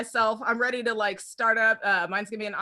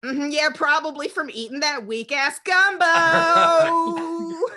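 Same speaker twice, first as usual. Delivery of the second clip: louder and higher, slow and sneering; smug troll jab; 5 semitones higher, 6 dB louder, slower than usual. Retold or imitated. Commenter mocking a dead grandma's gumbo, smug and loud. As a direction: drag out the insult with relish. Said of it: "they'd be like".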